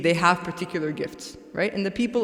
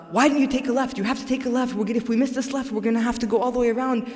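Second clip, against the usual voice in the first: higher-pitched, urgent imitation of a flustered navigator; mock fretting over a missed turn; agitated navigator; 5 semitones higher, faster than usual. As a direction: retell the insistent backseat-style directions in a raised pitch. Retelling the navigator's agitated demand to turn.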